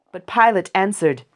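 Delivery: ordinary delivery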